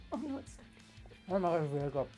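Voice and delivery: normal talking voice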